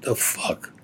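whisper. Retold or imitated. Hiding, spotting hand in brush.

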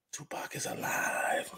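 slow breathy secret claim; slow hushed reveal; whisper. Acted.

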